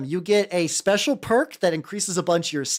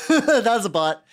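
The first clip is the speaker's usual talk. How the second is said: laughing; 5 semitones higher, 6 dB louder, slower than usual. Real, not acted.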